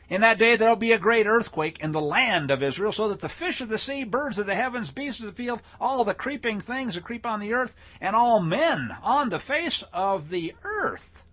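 high, insistent list building through the verse; declaimed scripture. Acted. Preacher reading who shall shake, emphatically declaimed. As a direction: proclaim each creature in turn, pitch held up. Reads prophecy aloud.

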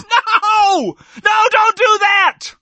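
high, loud, drawn-out desperate cry; mother's scream. Acted. Mother begging the king to spare her child, screaming. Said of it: "One of them screams".

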